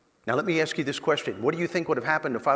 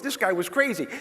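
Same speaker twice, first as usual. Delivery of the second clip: normal talking voice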